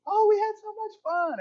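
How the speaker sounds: high, gushing fake delight; gushing mock friends. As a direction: squeal it like a smug friend showing off. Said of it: "everybody's like".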